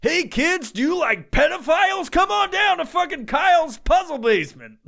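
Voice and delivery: creepy radio ad. Acted. Parody radio ad for kids.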